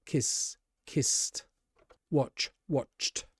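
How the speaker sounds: "kissed" and "watched" softly, ending in an unvoiced t; no throat voice on the endings of "kissed" and "watched"; voiceless endings. Shown as a demonstration. Teacher showing no voice in your throat, soft voiceless endings. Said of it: "you don't have to make any voice".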